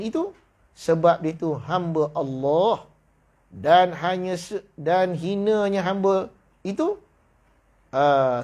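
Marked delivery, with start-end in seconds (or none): none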